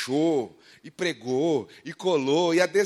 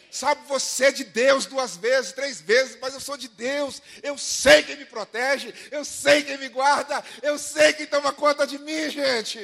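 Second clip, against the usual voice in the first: loud, slow, soaring preacher's cry; full-force sermon climax, slow and ringing; preaching at full force; 7 semitones higher, 5 dB louder, slower than usual. Acted. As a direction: proclaim it to the congregation, pulling each word out long. Preaching over music.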